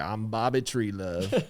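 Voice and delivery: slow, mild impression of an older golf buddy; lightly voiced old man introducing himself, slowly; drawn-out, laid-back self-introduction, lightly imitated; imitating Bobby Treelove. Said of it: "he talked like this".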